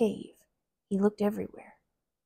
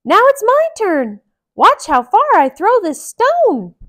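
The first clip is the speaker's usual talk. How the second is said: Mickey voice; 12 semitones higher, 14 dB louder than usual. Acted. Mickey speaks.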